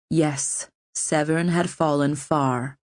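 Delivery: plain reading voice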